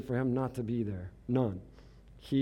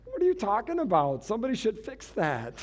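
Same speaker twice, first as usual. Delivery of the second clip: laughing mock outrage; 6 semitones higher than usual.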